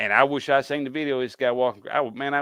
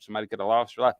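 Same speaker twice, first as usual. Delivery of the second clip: ordinary talk, nothing marked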